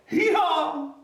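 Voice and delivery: high, loud braying hee-haw; donkey bray. Shown as a demonstration. Reading to children, loud high donkey hee-haw. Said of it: "Say it out loud with me".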